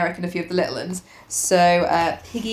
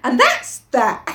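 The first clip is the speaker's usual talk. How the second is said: slow, firm, slightly raised finality; stubborn; 4 semitones higher, 4 dB louder, slower than usual. Acted. Piggy ending the argument with Ralph, stubborn. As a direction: close the matter slowly and firmly. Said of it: "said Piggy stubbornly".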